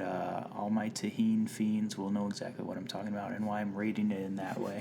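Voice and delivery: whisper. Pretend. Secret message to fans.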